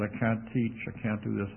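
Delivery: quiet, sad. Retold or imitated. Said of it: "He was very sad".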